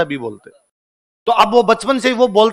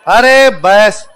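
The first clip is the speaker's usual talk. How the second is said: a very slow, louder drawn-out call to the class; 6 dB louder, much slower than usual. Real, not acted. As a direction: stretch it out to settle the room. Teacher calling class to order. (कक्षा को रोकने के लिए खींचकर ज़ोर से बोलना)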